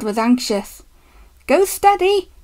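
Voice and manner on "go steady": high-pitched warning call; urgent, high shout; yelled. A narrator's reading. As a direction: yell it anxiously down the line. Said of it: "he yelled to Douglas".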